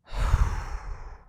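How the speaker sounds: long, loud exhale; heavy sigh. Acted. Calming down after snapping at a partner, heavy sigh. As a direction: blow out a big breath to calm down.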